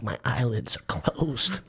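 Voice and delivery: soft, slow groggy answer; sleepy mumble. Retold or imitated. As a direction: say it half asleep. Sleepy reply to early call.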